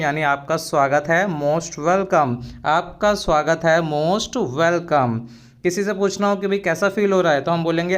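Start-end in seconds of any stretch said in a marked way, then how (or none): 1.3-2.4 slow, carefully spelled-out English phrase; slow and clear classroom model phrase; slow teaching demo. Shown as a demonstration. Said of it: "हम क्या बोलते हैं". 3.8-5.1 slow, carefully spelled-out English phrase; slow and clear classroom model phrase; slow teaching demo. Shown as a demonstration. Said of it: "हम क्या बोलते हैं".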